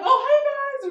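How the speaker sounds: high, loud welcome; mock eager greeting. Staff at Veg Nation to expected regulars, mock eager greeting. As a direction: pipe up as an eager host.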